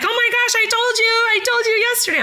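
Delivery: high, excited I-told-you-so voice; imitating Tracy. Friend Tracy scolding the narrator, high-pitched imitation.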